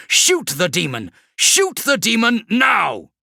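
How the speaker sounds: desperate shout. Acted. Kravrek ordering Zesius to fire, a high desperate yell. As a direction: scream the order.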